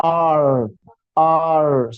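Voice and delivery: R with the tongue up to the palate; slow careful R, tongue touching the upper side; demonstrated R. Shown as a demonstration. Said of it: "it will be like this". (R ऐसे बोलें, जीभ तालु को छुए)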